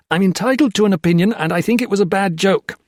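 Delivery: loud, high, fast angry retort; angry young officer lashing out; flaring up. A narrator's reading. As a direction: snap back hot and quick. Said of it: "Peter Marlowe flared".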